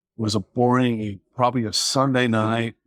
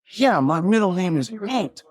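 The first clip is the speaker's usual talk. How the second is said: higher mock suspect voice, matter-of-fact; imitating suspect; 9 semitones higher than usual. Suspect tells the officer his middle name, in a higher mock voice. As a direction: play the suspect owning the silly name.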